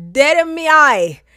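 loud, high indignant repeat; emphatic outrage. Real, not acted. Storyteller stressing the affront to listeners, loud indignant repeat.

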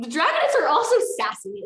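high, loud delight. Real, not acted. Reader gushing about the sassy dragonets, high and loud. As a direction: gush with glee.

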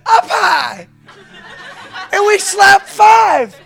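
loud, high triumphant shouting; whooping, over-the-top retelling; excited high-five reenactment. Retold or imitated.

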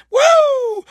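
long, high revival whoop; preacher's whoop. Real, not acted. Preacher exulting over the Spirit, high whoop.

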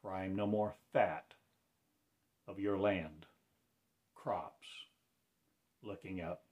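ordinary delivery

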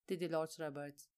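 ordinary delivery, nothing marked

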